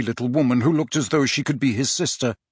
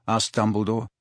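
usual speaking voice

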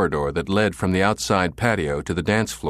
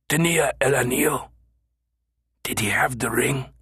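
menacing whisper; 6 semitones higher than usual. A narrator's reading. Killer whispering into his ear.

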